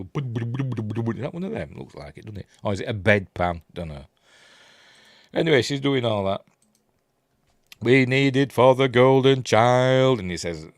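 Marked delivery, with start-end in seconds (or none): 0.0-1.1 rapid repeated percussive syllables; imitated drum rattle. Describing a spinning drum toy, imitated rattle. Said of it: "like a drum that has a balls". 7.8-10.2 louder, raised, slow mock declamation; mock film quote. Quoting her demand from the film, mock declaiming. Acting out the film's dialogue.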